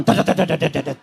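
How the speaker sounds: rapid low stutter "dat-dat-dat", dying away; imitated fan chopping. Father pushes child's hand into fan, imitated rattle. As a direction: rattle like blades hitting fingers.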